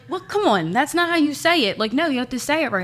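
high, mock scolding dad voice; mock stern dad; imitating dad. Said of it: "my dad was like".

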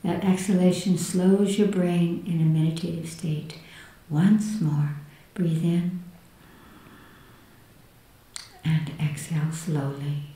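hushed and soothing, guiding inward. Acted. Leads a guided meditation.

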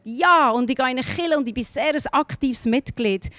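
normal talking voice

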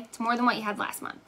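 quick repeat to herself, as a reminder. Real, not acted. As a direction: rattle it off as a self-reminder. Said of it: "That's what I keep telling myself".

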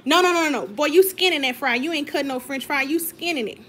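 loud, laughing-exasperated no-no-no; loud scolding. Real, not acted. Wife scolding husband for skinning fries, loud. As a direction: call him out loudly on the fries. Scolding his fry cutting.

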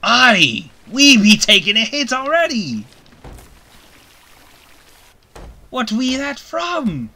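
high loud alarmed cry; alarmed shout. Acted. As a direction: yell as the ship is hit. Ship takes a hit.

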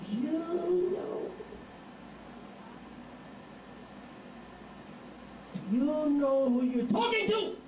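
high, slow, huffy woman's voice; indignant wife. Retold or imitated. Wife indignant at street evangelist.

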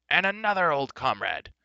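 high, booming welcome; delighted roar. A narrator's reading. Giant king spots his old comrade the bard, roaring. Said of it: "he roared".

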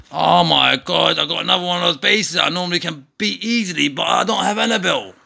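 excited self-quote. Retold or imitated. Gamer quoting own excited reaction.

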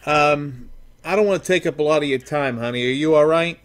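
ordinary delivery

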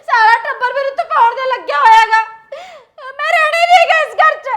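high, very loud outburst at the household; shouted, fed-up fury; furious shout. Acted. Wife's outburst in acted quarrel.

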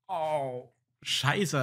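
ordinary talk, nothing marked

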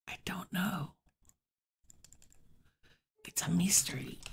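whispered mock mystery; whispered mock-spooky aside. Real, not acted. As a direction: breathe it like a secret. Spooky aside about heat.

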